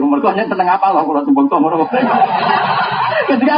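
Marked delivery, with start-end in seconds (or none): 1.9-3.2 high airy chuckle; breathy laugh. Real, not acted. Preacher laughing at his own joke, airy. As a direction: laugh it off lightly.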